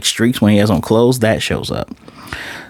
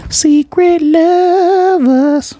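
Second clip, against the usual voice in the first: mock swooning falsetto; 4 dB louder, much slower than usual. Teasing two characters hugging.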